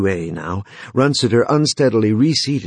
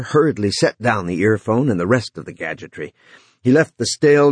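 plain reading voice

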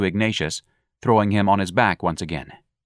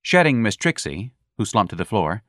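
usual speaking voice

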